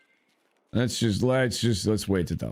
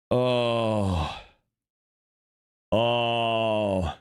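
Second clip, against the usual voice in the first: ordinary talk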